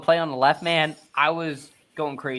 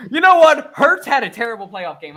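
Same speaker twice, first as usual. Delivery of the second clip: loud, high country-fan impression; country accent; 6 semitones higher, 9 dB louder than usual. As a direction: burst out in a hammed-up country accent. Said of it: "that's my best country accent".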